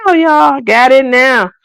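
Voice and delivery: playful victory crow; mock triumphant. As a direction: brag like you just glowed up. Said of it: "you're gonna see Tia like".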